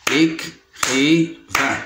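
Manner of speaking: ÉCRIVAIN very slowly chopped into é, cri, vain; long pause after each syllable of ÉCRIVAIN; teacher counting out the beats of ÉCRIVAIN. Shown as a demonstration. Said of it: "Il se compose de trois syllabes".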